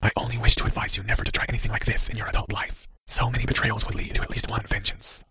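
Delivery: very soft, voiceless warning; whisper. A narrator's reading. Cloaked figure advises the boy, menacing whisper.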